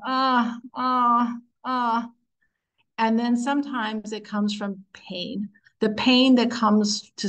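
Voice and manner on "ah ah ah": three separate sighed "ah" moans; evenly spaced, sustained little groans; demonstrated moaning. Shown as a demonstration. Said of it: "it's that moaning".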